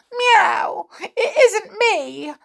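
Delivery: high, drawn-out frightened mew; frightened kitten. Acted. Kitten startled by her own reflection, frightened. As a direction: squeal it like a scared little cat. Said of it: "she said in a fright".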